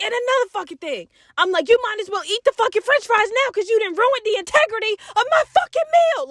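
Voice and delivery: high, ranting scold rising to the end; mock cussing out. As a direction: keep ranting, pitch climbing. Cussing cousin out in car.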